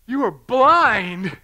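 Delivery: loud, high, stretched exclamation; drawn-out incredulous shout; astonished outburst. Retold or imitated. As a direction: blurt it out in hammed-up disbelief. Acts out his astonished outburst.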